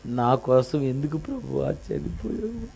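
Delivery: lightly hammed-up fake weeping; mock fake crying. Believer at communion, fake crying in mock. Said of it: "ఒక దొంగ ఏడుపు ఒకటి ఏడ్చి".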